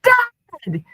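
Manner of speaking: high desperate shout of Dad. A narrator's reading. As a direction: cry out for him across the house. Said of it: "he shouts then".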